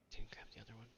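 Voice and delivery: whispered to himself; whispering. Real, not acted. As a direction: whisper it under your breath. Noticing a mistake, to himself.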